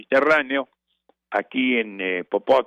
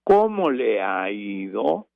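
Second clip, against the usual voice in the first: slow, marked questioning tune; question intonation; 2 semitones lower, slower than usual. Shown as a demonstration. Demonstrating a polite question, slow and questioning. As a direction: stretch it out as a real question. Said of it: "Son preguntas y tiene que acentuar".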